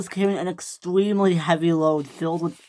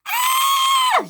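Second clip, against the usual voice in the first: very high startled scream; shrieking scream; 4 dB louder than usual. A narrator's reading. Millie bumped from behind, high scream. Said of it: "she screamed".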